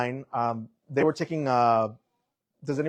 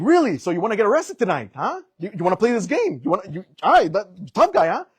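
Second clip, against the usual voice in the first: goading cop; 6 semitones higher, 5 dB louder than usual. Retold or imitated.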